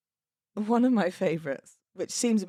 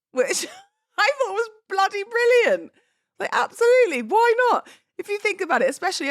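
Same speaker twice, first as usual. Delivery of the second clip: higher and louder, bursting with delight; excited gushing; 14 semitones higher, 6 dB louder than usual. Real, not acted. Celebrant gushing to couples about a TV monologue reading, excited high squeal. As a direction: squeal with enthusiasm at the idea.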